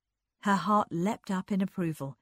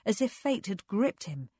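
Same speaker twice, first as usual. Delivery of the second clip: ordinary delivery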